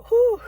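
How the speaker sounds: a long, loud shaky breath out to steady herself; shaky exhale. Real, not acted. Woman steadying herself while talking about her late cousin, loud shaky exhale. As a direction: blow out the grief, then carry on. Composing herself after tears.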